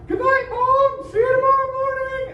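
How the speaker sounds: put-on high, loud little-kid goodnight; mock kid voice. Teacher imitating a child saying goodnight. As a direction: chirp it like a young kid.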